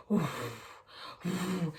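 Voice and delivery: soft, airy whooshing noise; imitated sound machine. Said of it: "It's going".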